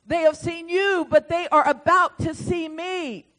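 loud, high, proclaiming the Lord's word; prophetic declaration. Retold or imitated. Preacher delivering the Lord's message, proclaiming loudly. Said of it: "message title prophecy".

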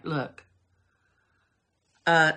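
ordinary talk, nothing marked

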